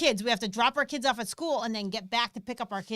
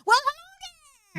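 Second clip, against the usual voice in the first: imitating her mom; faster than usual. Wife imitating her mother's greeting, high and chirpy. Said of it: "my mom ... she always says".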